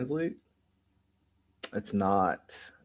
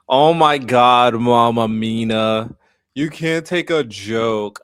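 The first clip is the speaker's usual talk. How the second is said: mock outrage; 8 dB louder, slower than usual. Streamer mock-outraged at chat.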